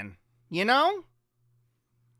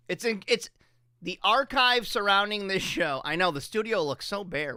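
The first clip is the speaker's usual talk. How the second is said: ordinary talk, nothing marked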